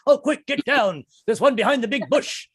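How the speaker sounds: high, urgent nature-host whisper-talk; excited Attenborough. Retold or imitated. Wildlife host spotting prey, high and urgent. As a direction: duck down and point out the sighting. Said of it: "I'm David Attenborough".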